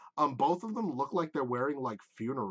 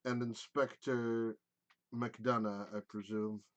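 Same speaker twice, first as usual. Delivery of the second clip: low, quiet, disdainful elf; cool contempt, held down and soft; a lightly lowered, icy mage voice; cold elf mage; 5 semitones lower, 8 dB quieter than usual. Acted. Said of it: "he goes".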